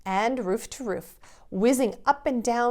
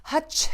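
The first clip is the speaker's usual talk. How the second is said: sneeze; 7 semitones higher than usual. Acted. Father Christmas sneezing.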